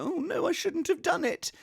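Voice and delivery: high-pitched, pathetic little lament; mock regret. Streamer imagining regret after bad feedback, mock anguish. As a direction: wail softly in a high mock voice.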